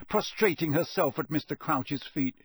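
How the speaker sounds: plain reading voice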